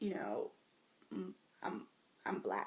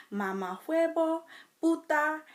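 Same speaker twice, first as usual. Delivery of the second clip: Spanish curse words; 8 semitones higher, 7 dB louder than usual. Shown as a demonstration. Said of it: "curse words in Spanish".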